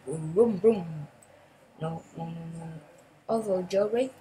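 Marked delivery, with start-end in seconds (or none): 0.1-1.1 engine vroom. Pretend. Imitating a car engine.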